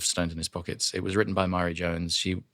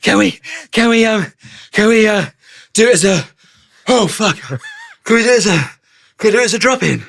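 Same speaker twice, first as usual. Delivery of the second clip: higher, louder, strained begging; worn-out actor pleading with the director; exhausted pleading; 13 semitones higher, 11 dB louder than usual. Retold or imitated. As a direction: beg hoarsely for a shortcut. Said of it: "I said".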